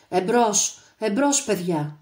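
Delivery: loud rallying call, slowing at the end; shouting encouragement. A narrator's reading. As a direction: cheer the men forward. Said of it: "φώναζε". (δυνατή ενθάρρυνση)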